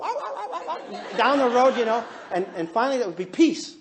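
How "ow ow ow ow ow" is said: string of high, quick yelps; high dog yelps. Retold or imitated. Dog sent off down the road, high yelping. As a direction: imitate a dog yelping as it flees. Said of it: "the dog would go".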